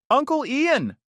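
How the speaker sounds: put-on high, loud little girl shout; excited kid yell. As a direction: call out like an eager child. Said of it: "Sophie yelled".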